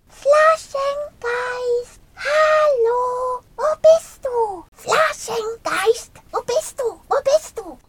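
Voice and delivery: long, high-pitched drawn-out calls for a lost friend; calling out. A narrator's reading. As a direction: call far and high for someone missing. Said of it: "rufen mussten".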